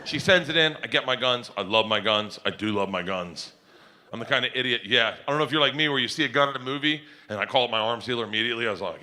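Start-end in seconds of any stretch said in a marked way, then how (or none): none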